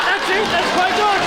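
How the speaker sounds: ordinary talk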